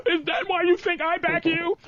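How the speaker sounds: a light hint of a wounded girlfriend; offended girlfriend. Girlfriend offended after the money joke, mock voice.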